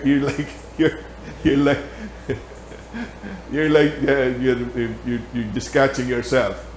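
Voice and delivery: usual speaking voice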